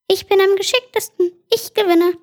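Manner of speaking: high, eager little worm. Acted. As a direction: pipe up high like a small worm. Desert worm boasts in the arena. (hohe Wurmstimme)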